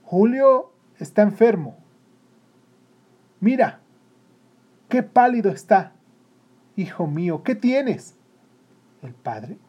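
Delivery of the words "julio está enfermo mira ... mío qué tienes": alarmed mother, high and loud; urgent, fretful motherly alarm; anxious. A narrator's reading. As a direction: exclaim with worry over a sick son. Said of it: "volviéndose ansiosamente hacia el padre".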